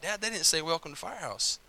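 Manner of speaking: disappointed aside. Retold or imitated. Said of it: "I was like".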